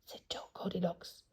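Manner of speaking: narration dropped to a whisper. A narrator's reading. Reader whispering the tag to sleepy listeners. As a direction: keep the tag line hushed and unvoiced.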